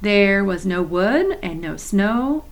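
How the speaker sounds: plain reading voice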